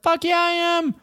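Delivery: very high cocky reply; mock triumphant runner. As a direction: squeak back full of himself. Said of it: "And I was like".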